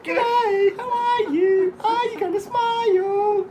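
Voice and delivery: very high, loud put-on voice; high-pitched, drawn-out silly heckle; clowning greeting. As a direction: squeal it goofily to crack up a guard. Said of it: "I'm going".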